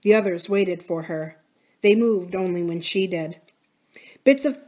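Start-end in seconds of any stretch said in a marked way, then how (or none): none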